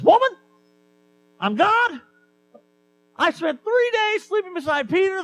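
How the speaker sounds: comically exasperated Jesus yelling at Martha; mock indignant Jesus. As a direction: ham up a cranky, put-out Jesus. Comic Jesus snaps back at Martha.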